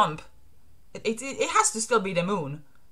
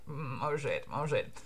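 low, hushed mutter to himself; muttered dread; 7 semitones lower, 12 dB quieter than usual. Acted. Orochi listening to the accusations, muttering under his breath. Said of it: "And Orochi's like".

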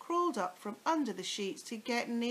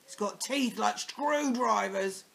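sly scaring; 2 semitones higher, 7 dB louder than usual. Acted. Mr Twit scaring wife.

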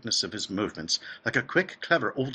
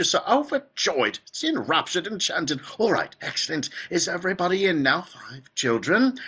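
high, fluty, bubbling host; Willy Wonka; 8 semitones higher, 4 dB louder than usual. A narrator's reading. Factory owner welcoming grandfather and starting the tour, high and effusive. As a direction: gush in a raised, energetic piping voice. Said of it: "His voice was high and fluty".

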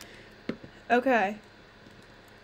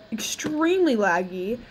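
ordinary talk, nothing marked

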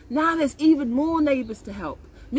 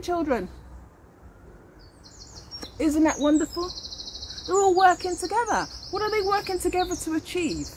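put-on teacher to children, animated and higher; talking to children; 8 semitones higher than usual. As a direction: rouse the class's curiosity.

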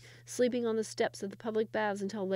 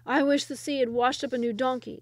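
louder, higher grumbling villager; grumbling peasant; 4 semitones higher, 7 dB louder than usual. Acted. A villager complains about the stranger, grumbling. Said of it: "said one voice".

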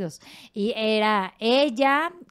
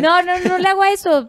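negación enfática; 10 semitones higher, 9 dB louder than usual. Real, not acted. Niega con énfasis entre risas.

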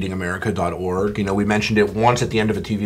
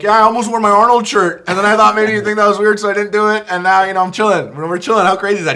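high, loud starstruck babble; gushing fan; 10 semitones higher, 6 dB louder than usual. Retold or imitated. Retelling his chat with his movie idol, gushing high and loud. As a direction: gush nervously in a raised voice.

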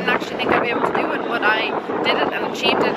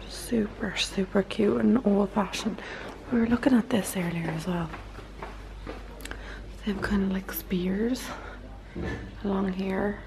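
whispering; 8 dB quieter than usual. Real, not acted. Whispered night house tour.

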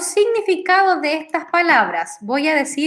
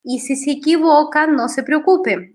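usual speaking voice

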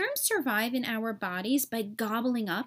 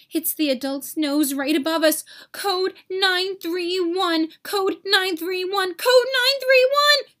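louder and louder alarm call, ending in a high shout; building alarm; 6 semitones higher, 7 dB louder than usual. Acted. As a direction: sound the alarm in full panic. Germs raising alarm.

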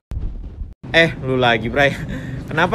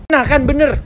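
high, loud gloating vendor; gloating seller; 10 semitones higher, 5 dB louder than usual. Acted. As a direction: crow that you were right.

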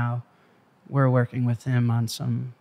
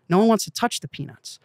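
ordinary talk

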